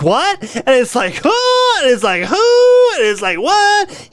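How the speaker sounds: lightly mocking a ditzy Valley girl; Valley girl mimic. Streamer mocking a co-host's habit, mild Valley girl voice. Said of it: "He talks like a fucking Valley girl".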